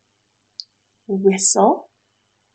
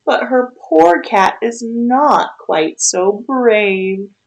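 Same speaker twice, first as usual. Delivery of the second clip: put-on lively read-aloud for a child; storybook reading; 4 dB louder than usual. A narrator's reading. Reads rhyming picture book aloud.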